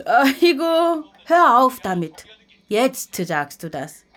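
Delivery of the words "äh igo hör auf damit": higher, louder flustered brush-off; flustered protest. Acted. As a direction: wave the flattery away. Grandma brushing off compliment.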